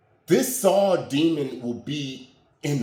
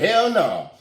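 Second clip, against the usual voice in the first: disgusted refusal; 6 semitones higher, 7 dB louder than usual. Retold or imitated.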